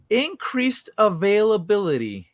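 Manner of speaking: lightly voiced stoned friend, mock disbelief; friend's stoned echo. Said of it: "he stops".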